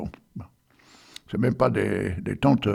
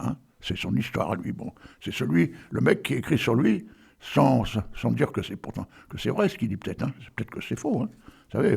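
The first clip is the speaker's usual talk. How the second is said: normal talking voice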